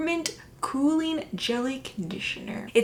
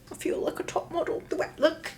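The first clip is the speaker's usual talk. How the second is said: put-on British accent, softer; 4 semitones higher, 4 dB quieter than usual. Vlogger showing off her wet look, mock British accent. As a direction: slip playfully into a British accent. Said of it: "I don't know why the British accent".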